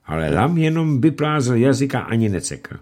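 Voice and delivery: plain reading voice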